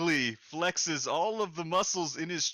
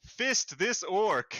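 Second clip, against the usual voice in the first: proud muscle-man pronouncement, slowed at the end; lightly voiced barbarian; 6 semitones higher, 3 dB louder than usual. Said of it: "He says".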